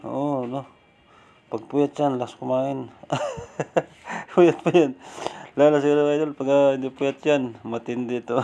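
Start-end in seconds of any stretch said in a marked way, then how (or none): 2.9-3.8 a sudden squeal of a laugh; high burst of laughter. Real, not acted. Vlogger laughing at his friend's appetite, squealing laugh. 4.3-8.4 excited teasing. Real, not acted. Laughing, teasing the eater.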